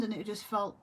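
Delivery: ordinary talk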